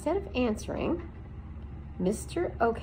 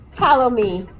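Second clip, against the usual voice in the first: Aerith barks a loud command; a porcupine's loud, gruff order; Aerith's command; 3 semitones higher, 10 dB louder than usual. A narrator's reading.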